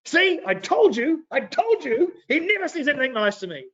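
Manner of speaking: hammed-up aggrieved I-told-you-so; petulant complaint. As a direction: whine triumphantly like a sulky king. Ahab acted, complaining to Jehoshaphat.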